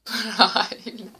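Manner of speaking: slow stretched praise; drawn-out praise. Real, not acted. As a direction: stretch out the word with delight.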